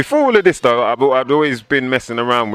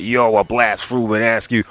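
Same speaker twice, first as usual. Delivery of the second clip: a lightly put-on American rapper voice; a hint of American accent; mock American accent. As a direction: imitate a UK kid faking American swagger. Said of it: "I always felt weird when people like".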